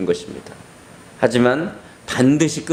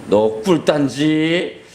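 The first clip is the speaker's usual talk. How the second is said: drawn-out menacing hint; sly slow blackmail; slow sly threat; 5 semitones higher, much slower than usual. Acted. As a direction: dangle the secret over him slowly. Neighbour threatens the child.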